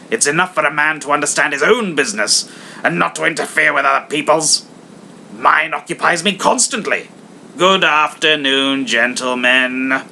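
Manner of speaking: hammed-up stern miser; stern Scrooge. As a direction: scold them coldly. Scrooge dismisses.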